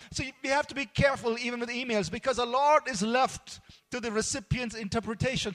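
ordinary delivery

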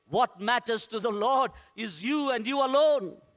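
normal talking voice

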